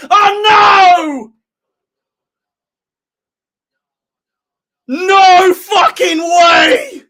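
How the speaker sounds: loud, high, very drawn-out cry; disbelieving shout. Real, not acted. Fan unable to believe the goal, loud stretched shouting. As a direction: stretch every word in real disbelief.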